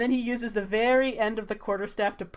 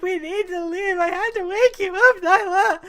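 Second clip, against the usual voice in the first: defensive; 10 semitones higher, 5 dB louder than usual. Acted. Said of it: "Salvador's like".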